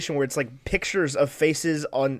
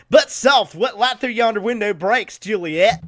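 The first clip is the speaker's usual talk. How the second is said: mock Southern twang on Shakespeare, pitched up and loud; 7 semitones higher, 6 dB louder, slower than usual. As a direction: ham up a hillbilly reading Romeo and Juliet. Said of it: "there's no way they were sitting there like".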